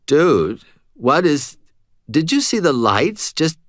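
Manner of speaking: confused stoner. A narrator's reading. Confused stoned cashier speaks.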